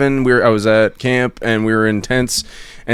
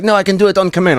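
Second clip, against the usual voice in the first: put-on French accent; 9 semitones higher, faster than usual. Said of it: "he'd be like".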